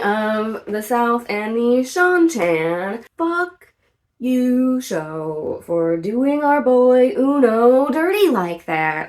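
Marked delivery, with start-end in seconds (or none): 3.2-9.1 loud, higher-pitched outburst; indignant rant. Real, not acted. Fan scolding the show over a favourite character, indignant. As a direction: tell the show off with force.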